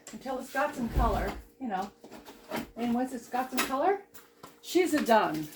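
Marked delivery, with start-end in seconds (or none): 4.6-5.5 playful mock Italian accent. Home cook announcing the dish is browned, mock Italian accent. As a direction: put on a jokey Italian cook's accent. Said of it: "I could do my Italian accent".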